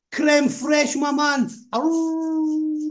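high, loud hype greeting; excited high-pitched shout to a fan; hyped shout-out. Real, not acted. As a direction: holler it out, ending in a long howl. Hyped shout-outs to chat names.

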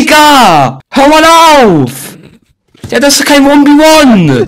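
loud, high, indignant yelling; angry shout. Real, not acted. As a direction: shout your protest at the unfair fight. Angry protest at unfair fight.

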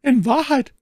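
high, loud lady's exclamation; exclaiming. A narrator's reading. Milady suspects her champion hesitates, exclaiming. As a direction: call it out, pitched right up. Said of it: "rief sie".

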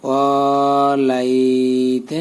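ওয়ালাইত very slowly, "waa" and "lai" both held; long madd. Shown as a demonstration. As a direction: draw out both vowels of ওয়ালাইত. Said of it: "এখানেও টান". (ওয়ালাইত, দুই জায়গায় টান)

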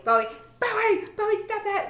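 put-on frantic parent yelling at a kid; frantic mom yell. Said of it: "frantic state".

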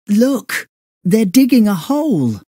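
high surprised cry of discovery; surprised exclamation. Acted. Boy spotting the men digging, surprised and high. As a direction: blurt it out, amazed at what you see. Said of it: "exclaimed Sandy in surprise".

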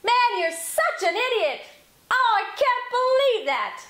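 high, loud furious yell. Shown as a demonstration. Coach showing how yelling goes up. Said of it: "automatically go high whenever you wanna get louder".

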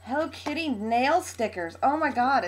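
ordinary talk

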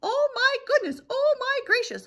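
high, loud flustered chick; huffing panic. Acted. Chicken Little answers the duck, huffing in panic. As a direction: huff it out in hammed-up alarm. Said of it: "huffed Chicken Little".

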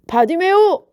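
high, loud hail; bystander's loud summons; calling out. A narrator's reading. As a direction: call someone over by name. Bystanders call the beggar over.

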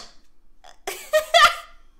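high-pitched, loud laugh. Real, not acted. Player laughing after yelling at the game, high laugh.

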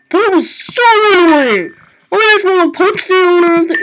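loud, high frustrated rant; raging. Real, not acted. Gamer raging at the impossible quiz, loud and high. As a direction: vent at the game. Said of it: "Sorry, I'm just raging".

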